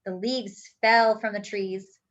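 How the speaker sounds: ordinary delivery, nothing marked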